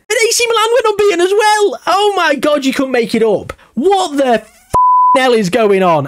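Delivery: very high, amazed outburst; real shock nearly swearing; gobsmacked. Real, not acted. Gamer to viewers, stunned by another unbeaten team, very high outburst. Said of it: "I am absolutely gobsmacked".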